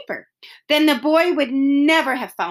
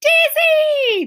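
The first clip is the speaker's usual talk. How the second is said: much higher, louder call of the dog's name; shouted; 6 dB louder than usual. Acted. The boy shouting to his lost dog, high and loud. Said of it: "the boy shouted".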